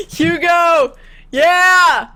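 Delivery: high, loud cheer. Real, not acted. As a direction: whoop for your buddy. Cheering friend's burn.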